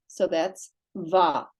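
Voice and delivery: va said slowly, the v joined to an open ah; sounded-out syllable. Shown as a demonstration. Teacher blends letter and vowel into va for students. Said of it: "I'm gonna pronounce this".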